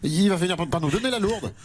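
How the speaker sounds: put-on thick comic provincial French accent, stammering in indignation. Mayor protests remark on his accent, spluttering provincial French accent. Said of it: "ce joli petit accent provincial".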